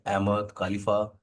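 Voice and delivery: ordinary talk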